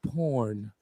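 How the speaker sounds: low, soft deadpan; mock calm. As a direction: say it calmly, low. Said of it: "she's all calm with it".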